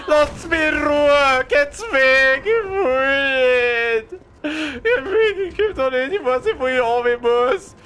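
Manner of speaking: high, whiny panicked pleading; mock panic whine. Real, not acted. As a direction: plead and whimper, half laughing. Zombies surround him.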